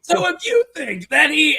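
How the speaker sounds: high loud mock Gilbert; imitating Gilbert Gottfried. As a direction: ham up the roast voice. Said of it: "then he's just like".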